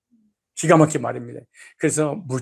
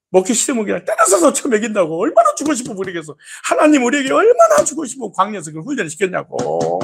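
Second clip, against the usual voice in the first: fervent preaching; 7 semitones higher, 4 dB louder than usual. Real, not acted.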